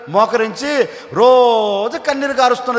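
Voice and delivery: normal talking voice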